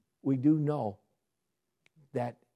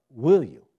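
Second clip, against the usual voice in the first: Will punched louder; Will you, with Will louder and stressed; 12 dB louder than usual. Shown as a demonstration. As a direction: lean hard on Will. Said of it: "Not, "Lord, can you?" but".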